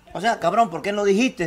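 softly voicing the mute's words, under the breath; hushed translating voice. Translating the mute's signs for his friend, hushed and low. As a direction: murmur it like you're translating signs quietly. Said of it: "te estoy traduciendo".

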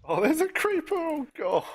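sudden high-pitched yelp of fright; startled panic. Real, not acted. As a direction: shriek as if something jumped you. Creeper appears suddenly.

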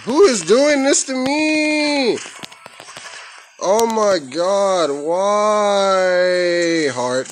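high, slow hammed-up wail; theatrical high-pitched despair; mock wailing. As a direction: cry out like a cartoon victim. Dying in game, mock despair.

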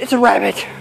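normal talking voice

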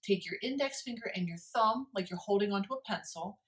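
plain reading voice

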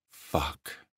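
growled under the breath; low growl. Acted. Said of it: "he growled".

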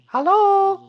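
very high, loud, long hello; child calling back. A narrator's reading. Boy answering the bear overhead, high calling. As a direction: sing out a reply as a little boy.

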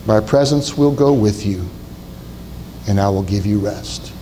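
low, calm voice of God giving a promise. Acted. Preacher voicing God's reply.

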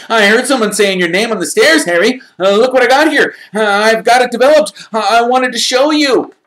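put-on high, loud, eager boy's voice; excited young fan. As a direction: gush at your hero like a starstruck kid.

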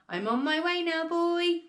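high, carrying call. A narrator's reading. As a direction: call down to the boy from above. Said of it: "she called down to George".

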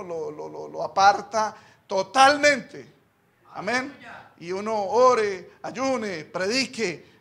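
loud, shouted sermon build; full-force preaching. Real, not acted. Pastor shouts to the brothers about hard mission work. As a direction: preach hard, hammering each line.

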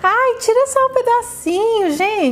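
mock Giovanni voice. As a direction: mimic him asking for just a little piece. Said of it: "Giovanni fala".

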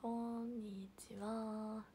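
very slow, drawn-out こんにちは; YouTuber greeting. Retold or imitated. Imitating a YouTuber's signature hello to viewers, very slow. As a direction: stretch each syllable like a streamer's opening line. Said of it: "トーアさんかね".

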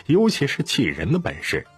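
ordinary delivery, nothing marked

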